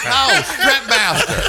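slow, high, laughing correction. Real, not acted.

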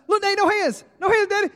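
put-on very high, loud kid holler; gleeful child shouting from a ride; excited little boy. Boy showing off to Dad from the coaster, thrilled.

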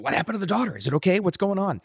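a touch of pretend panic; mock freaking out. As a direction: lightly act out a listener's alarmed questions. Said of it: "you're probably freaking out going".

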